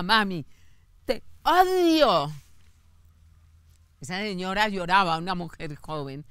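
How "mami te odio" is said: a son shouting at his mother; retold son's yell. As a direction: hurl it slowly at mom. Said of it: "y le grita".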